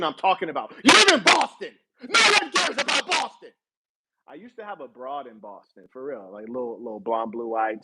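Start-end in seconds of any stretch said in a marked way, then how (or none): 0.8-3.5 high-pitched, hammed-up shout; mock yelling. Streamer ridiculing deluded Boston fans, high mock yell. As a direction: yell it in a far higher mocking voice.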